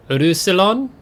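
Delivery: Roussillon said with a French accent. Shown as a demonstration. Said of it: "Trying to maybe throw a French accent on that".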